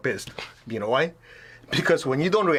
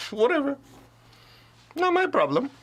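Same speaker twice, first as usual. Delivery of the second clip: dismissive whatever; 12 semitones higher than usual. Retold or imitated. Brushing off criticism.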